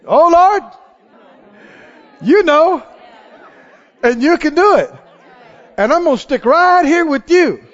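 preacher modelling a bold believing answer; faith response. Retold or imitated. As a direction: build to a triumphant shout of faith. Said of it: "And when the Lord asks you".

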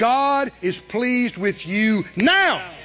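high, loud preaching call; full-force preaching. Real, not acted. Preacher calling the congregation to answer, high loud preaching. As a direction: build it to a peak.

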